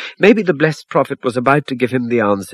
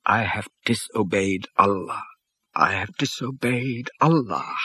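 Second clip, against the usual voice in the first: softly distressed; 5 dB quieter than usual. A narrator's reading. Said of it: "he cried".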